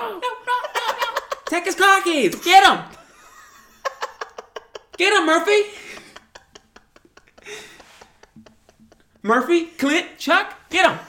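high shouted Boston gang orders; Boston tough guy. Acted. Gang leader siccing his squad on a driver, Boston tough-guy shout. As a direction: yell to the boys in a mock Boston accent. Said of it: "those Boston tough guys".

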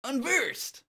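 very high mock battle cry; Ventus. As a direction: echo the young hero in a squeaky pitch. Echoes the Ventus impression.

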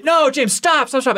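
high-pitched staged protest; hammed-up, high, insistent plea; mock urgent pleading. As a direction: play an alarmed helper begging him to quit. Acts out assistants pleading with singer.

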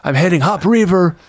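high, strained mock scream building in pitch; Van Damme fake scream. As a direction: imitate screaming without really letting it out. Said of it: "he's doing a fake scream".

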